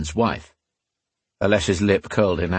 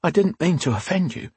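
high, timid servant's apology; shocked, intimidated; 8 semitones higher than usual. A narrator's reading. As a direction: apologise meekly in a raised pitch. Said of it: "the words coming out as a croak".

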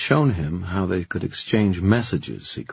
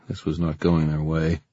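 ordinary delivery, nothing marked

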